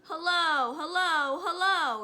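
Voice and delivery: high, loud, clear call; a parrot shouting from another room. Acted. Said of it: "a voice came loud and clear".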